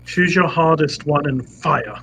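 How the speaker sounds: normal talking voice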